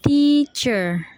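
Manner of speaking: "teacher" in two long, separated syllables. Shown as a demonstration. Student repeating the syllables of "teacher", drawn out and split. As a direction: say "teacher" as tea, pause, cher. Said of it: "the syllables are".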